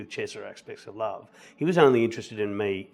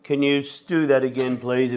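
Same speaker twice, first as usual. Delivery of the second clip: raised, louder mock call from the auditorium; intimidating auditioners; 4 semitones higher, 6 dB louder than usual. Audition panel calling to a nervous actor, intimidatingly. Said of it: "and being really intimidating".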